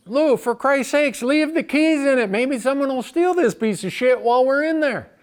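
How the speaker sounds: loud, high fed-up shout; exasperated yell. Retold or imitated. Narrator yells at Lou about his car, loud and fed up. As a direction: blast him with it.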